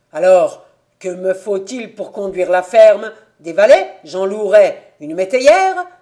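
very loud, rising challenge; heated. Acted. Invalid son arguing he can run the farm, heated. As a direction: fire off the questions loudly, climbing at the end. Furious son argues his claim.

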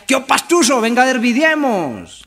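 high, loud teasing call; mocking paisa. Paisa taunting the pastuso, high loud mocking call. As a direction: taunt him like a cocky paisa.

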